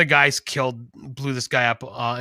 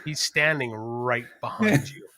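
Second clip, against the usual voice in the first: laughing hushed warning; 6 semitones lower, 7 dB quieter than usual. Retold or imitated.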